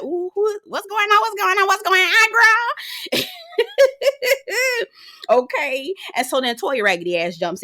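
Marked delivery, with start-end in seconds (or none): 0.0-3.2 high, eager gossip voice; nosy gossip. Retold or imitated. Vlogger imitating Andy eating up the drama, high and nosy. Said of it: "eating like". 3.3-4.8 high cackling laugh. Real, not acted. Vlogger cackling after the impression, high laugh.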